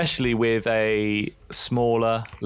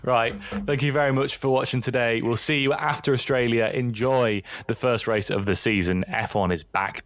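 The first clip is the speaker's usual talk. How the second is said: ordinary talk, nothing marked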